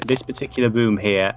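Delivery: usual speaking voice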